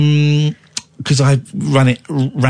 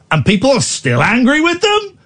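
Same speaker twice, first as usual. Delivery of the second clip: host ranting at royal critics in disbelief; indignant disbelief; 10 semitones higher than usual. Real, not acted. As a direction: demand an answer from the critics. Indignant rant about critics.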